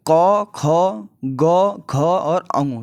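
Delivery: each letter said slowly and clearly; reciting the consonants. Shown as a demonstration. Teacher to students, demonstrating ka-varga letters slowly. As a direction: sound out क, ख, ग, घ, अंगु one by one from the throat. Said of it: "तो इसमें क्या है".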